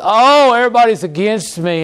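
high, fast whiny wail, hammed-up; mock self-pity. Preacher mimics people sulking in bed, whiny mock wail. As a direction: play the sulking martyr, mockingly. Mocking a self-pitying complaint.

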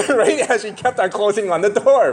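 laughing while talking; chuckling through the words. Real, not acted. Laughing at the story.

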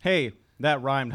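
ordinary delivery

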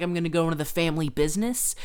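fast, loud and eager; mock eager kid. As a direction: rattle it off like an excited kid. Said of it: "now he's like".

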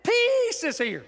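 high, slow, laughing delight; angel's joyful announcement. Retold or imitated. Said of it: "You know what they said?".

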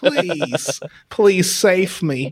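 slow, drawn-out mock plea; pleading Yiddish robot. Co-host as robot begging rescue, slow hammed-up plea. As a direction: stretch each word in a put-on accent. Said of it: "I am the robot".

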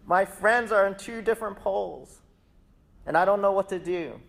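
high-pitched, fed-up mock rant; exasperated. Social media user pleads with God, mock exasperated. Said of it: "Go on there and like".

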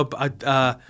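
usual speaking voice

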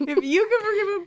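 high, laughing through the words; speaking while laughing. Real, not acted. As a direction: giggle the line out.

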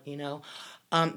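ordinary talk

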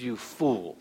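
quiet, low rebuke; God's rebuke. Acted. God quietly calling the rich man a fool. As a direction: pronounce it softly, gravely. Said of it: "then God says".

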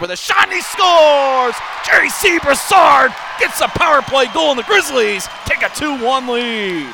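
shouted goal call. Real, not acted.

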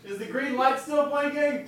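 high, distant called-out question; calling from afar. Shown as a demonstration. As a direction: shout a question from the kitchen. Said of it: "go to the kitchen and yell".

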